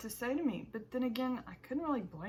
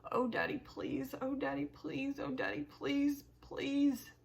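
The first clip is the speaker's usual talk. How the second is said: higher, pleading repeated please; desperate plea; 6 semitones higher, slower than usual. Acted. Boy in the snowstorm begging for his dad: high desperate plea. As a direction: beg into the cold, near tears.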